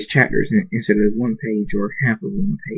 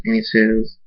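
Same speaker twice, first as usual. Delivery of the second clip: plain reading voice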